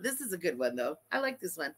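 normal talking voice

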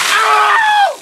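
sudden high yelp from icy water; cold shock yelp. Real, not acted. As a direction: yelp as freezing water hits. Ice water dumped on him.

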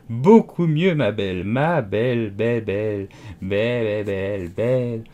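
words stretching into a sheep's bleat; sheep-like drawn-out bleating. A narrator's reading. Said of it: "Le dernier mot fut un long bêlement".

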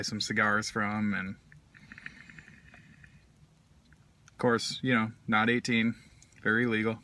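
speaking around pipe stem; words between puffs; pipe in mouth. Real, not acted. Seen 2: Pipe stem held between lips; briefly removed at 3.5s, smoke exhaled.

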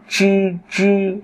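"chư" with tongue curled back, unaspirated; curled-tongue sound. Shown as a demonstration. Teacher drills students, "chư" retroflex and unaspirated. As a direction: hold "chư" high and level, no air puff. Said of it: "vị trí như trờ nặng".

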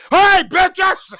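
loud, high party yell; mock shout of the freaky kid; wild shout. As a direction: burst out with a loud, rowdy greeting. The same kid turned wild at night.

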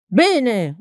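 mild shout of assent from a crowd; crowd's yell. A narrator's reading. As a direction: call out approval, voice lifted. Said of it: "urlò il popolo".

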